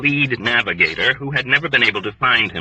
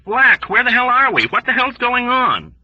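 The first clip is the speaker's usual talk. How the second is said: high, loud panicked shrieks; shrieking; 13 semitones higher, 4 dB louder than usual. A narrator's reading. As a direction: shriek it in panic over the intercom. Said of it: "McWatt was shrieking over the intercom".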